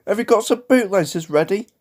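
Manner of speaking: high, teasing little-engine voice; a rude taunt in a high character voice; cheeky tank engine. A narrator's reading. Little tank engine mocking James, cheeky. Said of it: "he ran off laughing rudely".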